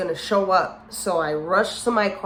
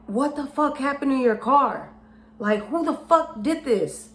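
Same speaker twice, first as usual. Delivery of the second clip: higher-pitched imitated indignant demand; outraged brother; 6 semitones higher than usual. Brother seeing his sister's keyed car, imitated outrage. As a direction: act out a brother demanding answers.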